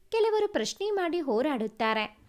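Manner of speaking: plain reading voice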